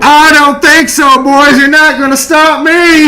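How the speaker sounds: high, loud, drawn-out bravado; defiant shout. Acted. Runner taunts the guards chasing him, defiant shout. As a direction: holler defiantly on the run. Defies guards while running.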